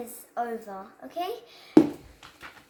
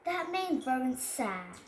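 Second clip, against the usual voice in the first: usual speaking voice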